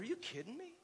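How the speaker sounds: high, soft, delighted are you kidding me; amazed disbelief. Retold or imitated. Pastor telling church of his daughter, high amazed delight. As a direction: let it rise up high in happy disbelief.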